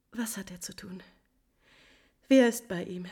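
hushed, anxious whisper; whispering to herself in dread. Acted. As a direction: breathe the question under your breath. Countess's whispered anxious question.

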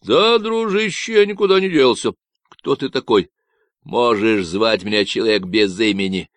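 loud, nasal Texan ranting about ecologists; a cocky stranger sounding off; Davy's Texan voice. Acted. Stranger on the radio ranting about ecologists, nasal Texan.

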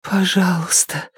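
faint, imploring woman's voice; pleading. A narrator's reading. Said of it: "взмолилась Сара".

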